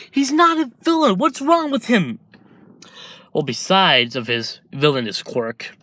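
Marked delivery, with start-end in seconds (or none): 0.1-2.2 outraged mother defending her son; indignant protest. Acted. Inko snapping back at the doctor, high outraged voice.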